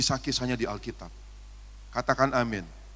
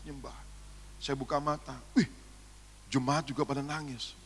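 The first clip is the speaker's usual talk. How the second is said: normal talking voice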